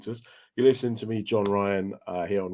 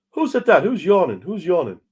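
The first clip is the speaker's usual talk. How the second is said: high, loud challenge to the crowd; raised, indignant stage voice; irate performer calling out; 8 semitones higher, 8 dB louder than usual. Acted. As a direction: demand the culprit from the stage. Stage act challenges a yawning audience member.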